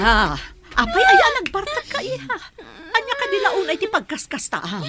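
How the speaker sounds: high, loud cry of alarm; shocked outcry. Acted. Mother cries out in shock at her drunk daughter. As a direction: shriek in disbelief at a drunk child.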